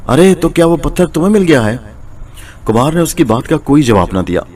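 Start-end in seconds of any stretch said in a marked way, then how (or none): 0.1-1.8 higher, startled exclaiming; wife suddenly realises the stone is found; shocked cry. A narrator's reading. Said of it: "चौंक कर उसे देखा और चिल्लाई".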